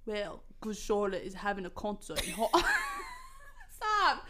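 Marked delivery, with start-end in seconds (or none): 0.1-2.5 lightly attempted British accent. Draco announcing a concert, British accent. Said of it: "I gotta be British".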